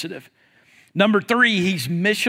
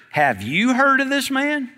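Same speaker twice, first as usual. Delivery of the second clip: higher, rising nosy question; gossipy question; 6 semitones higher than usual. Acted. Churchgoer asks another about a newcomer, gossipy. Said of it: "what we see happen a lot of times in church".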